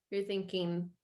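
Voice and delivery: ordinary delivery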